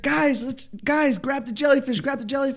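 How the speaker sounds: high, urgent mock urging; imitated eager guide.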